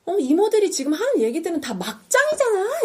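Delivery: high-pitched acted outburst of frustration; imitated fed-up shout, pitch shooting up; exasperated yell. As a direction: snap and cry out at them. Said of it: "막 소리를 질러버려요".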